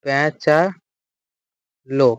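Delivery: প্যাঁচালো drawn out syllable by syllable; teacher spacing out প্যাঁচালো; slow dictation. Shown as a demonstration. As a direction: say প্যাঁচালো very slowly with gaps, for note-taking. Teacher repeats word slowly to dictate.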